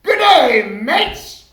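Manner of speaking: loud, high mock Australian "Good day, mates!"; fake Australian greeting. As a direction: bellow it like a cartoon Aussie. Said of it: "he'll say something in Australia".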